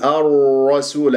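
الرسول with lips rounded on the ra. Shown as a demonstration. Teacher shows students the error, lip-rounded الرسول. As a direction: round the ra in الرسول towards a dark o. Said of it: "هكذا أكور شفاف الراء".

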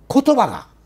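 high, loud stress on 言葉が; 言葉が lifted and hit hard; emphasis. Real, not acted. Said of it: "stressing the key point".

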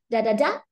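"da da da" rising onto the last DA; third-syllable stress. Shown as a demonstration. Teacher showing stress position three, last beat of "da da da" stressed. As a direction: beat out da-da-DA, climbing at the end. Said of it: "Âm ba là".

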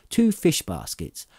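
ordinary delivery, nothing marked

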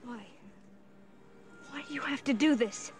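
high, loud desperate plea; distraught plea. Acted. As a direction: beg him to stop.

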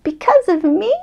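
small child asking, lightly acted; curious little cub. Child character asks in surprise.

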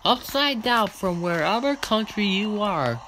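drawn out, slow joking threat; slow mock threat. Gamer mock-threatening a rival driver, slow. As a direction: threaten slowly, half-joking.

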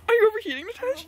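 overheated exclaim. Real, not acted. Hot car, calling out.

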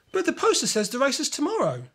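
a friend gently noting the problem; pointing out. A narrator's reading. Lewis reminds Kimmy the race is tomorrow, matter-of-fact. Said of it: "Lewis points out".

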